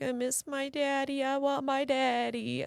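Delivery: put-on high, soft, drawn-out child's whimper; mock a little girl tearfully wanting her dad; imitating crying daughter. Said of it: "she was just crying".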